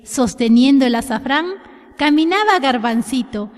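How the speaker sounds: ordinary delivery, nothing marked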